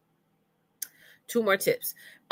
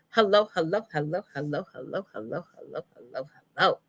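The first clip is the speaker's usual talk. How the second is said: repeated hellos. Real, not acted. Coach makes up for forgotten greeting, fading hellos. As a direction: let the hellos trail off like an echo. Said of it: "I forgot to say hello".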